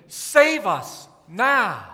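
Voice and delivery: slow chanted crowd plea. Retold or imitated. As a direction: plead each word slowly. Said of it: "They were singing".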